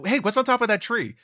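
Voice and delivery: high, loud, eager call; excited Luffy read-aloud. Acted. Reading Luffy calling out.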